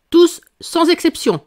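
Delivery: "Tous, sans exception" high and loud, each part hit; emphatic repetition. A narrator's reading. King insisting to Alice, emphatic on "Tous, sans exception". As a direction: lean hard on "Tous", pause, then "sans exception". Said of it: "répéta-t-il en appuyant sur ces mots".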